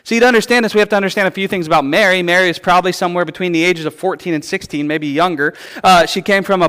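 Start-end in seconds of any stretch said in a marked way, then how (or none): none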